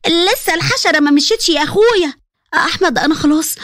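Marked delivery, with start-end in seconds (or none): none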